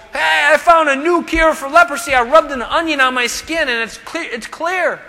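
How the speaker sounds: mock excited. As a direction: hype a silly home cure. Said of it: "The leper didn't go".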